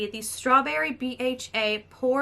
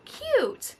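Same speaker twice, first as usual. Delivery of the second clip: squeaky cute; 13 semitones higher than usual. Real, not acted.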